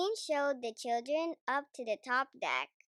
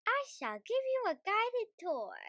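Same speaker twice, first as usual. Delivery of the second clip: much higher, regal and gracious; lightly voiced Queen; 10 semitones higher than usual. A narrator's reading. Queen offering the children a tour, regal voice.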